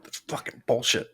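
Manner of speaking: very soft grumbling under the breath; muttered complaint. Retold or imitated. Son grumbling quietly about his mom's ruling. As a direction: grumble it almost inaudibly. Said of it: "I'm like".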